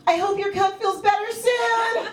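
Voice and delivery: high, drawn-out cheery wish; cheery card greeting. Acted. Comedian reading out a get well card, cheery. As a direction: pitch it high and stretch it out.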